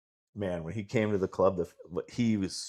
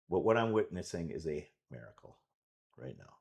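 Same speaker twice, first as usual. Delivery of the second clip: normal talking voice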